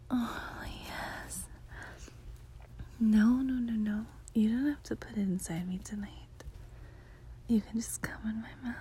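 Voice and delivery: soft intimate whisper; breathy bedroom whisper. Acted.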